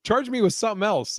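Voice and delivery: high, fast, half-laughing protest; mock incredulous. His first incredulous thought.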